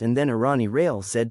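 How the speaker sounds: ordinary delivery, nothing marked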